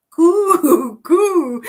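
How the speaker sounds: high, loud "coo" like a bird; pigeon cooing. Shown as a demonstration. Joining viewer in pigeon talk, loud high cooing. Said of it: "[name] speaks pigeon too".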